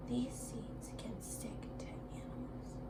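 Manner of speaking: soft whisper to yourself; breathy classroom whisper, no voice. Shown as a demonstration. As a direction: whisper the answer under your breath. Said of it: "whisper that, that answer to yourself".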